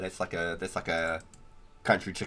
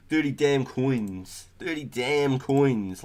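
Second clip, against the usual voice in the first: slow, loud, stunned at the price; outraged disbelief; 6 semitones higher, 5 dB louder, much slower than usual. Real, not acted. Ranting to friends about a pricey feather, slow disbelief. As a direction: drag it out in disbelief.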